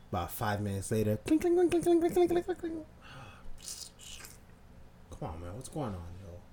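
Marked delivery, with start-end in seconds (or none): 1.2-2.7 high, rapid clinking noises; high metallic clink imitation; imitated clinking. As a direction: rattle off high-pitched clinks. Imitates noise heard again.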